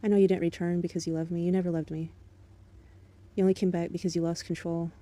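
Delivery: ordinary talk, nothing marked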